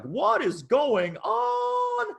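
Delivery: high-pitched retold cry of disbelief; stunned disbelief. Recalling his first six-figure month, high astonished cry. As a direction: throw it up high, like you can't believe your eyes. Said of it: "I was like".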